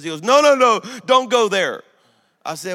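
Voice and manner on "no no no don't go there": loud, high mock protest; alarmed objection. Passenger cutting off a Bible verse, loud protest. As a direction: cut in to shut the subject down.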